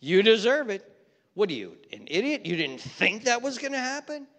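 scornful, hammed-up taunt; mock gloating. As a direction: rub it in, playing the vengeful onlooker. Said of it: "We want things to go bad".

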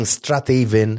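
Strathaven as strath-AY-ven, slow and loud; incorrect Strathaven. Shown as a demonstration. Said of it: "whatever you've been saying".

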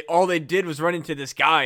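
ordinary delivery

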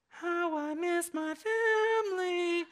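high, slow, dreamy longing; milk it like a movie's tearful moment; mock sentimental Kevin. Preacher parodying the movie's moral, slow and high. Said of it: "the moral of the story is like".

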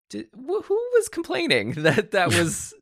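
high, slow mock disbelief; hammed-up high incredulous voice; mock incredulous quote. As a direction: squeak it out in fake outrage. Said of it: "I was just like".

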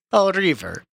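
ordinary talk

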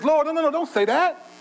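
acting out a friend refusing to hear it; urgent protest. Acting Peter's protest to Jesus.

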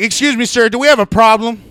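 mock stern staff member asking about trouble; stern official. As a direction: challenge him like a stern guard. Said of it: "she's like".

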